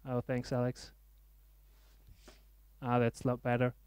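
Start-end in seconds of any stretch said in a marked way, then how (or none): none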